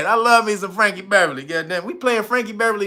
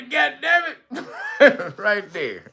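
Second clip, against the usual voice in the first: ordinary talk, nothing marked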